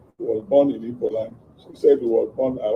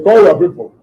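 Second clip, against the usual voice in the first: loud, raised command; retold barked order; 5 semitones higher, 10 dB louder than usual. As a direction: bark it out like a kidnapper giving orders. Kidnappers ordering him to phone.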